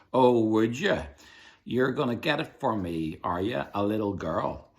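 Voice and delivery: captain mocking the girl; mocking pirate. A narrator's reading. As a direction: taunt her like a smug pirate.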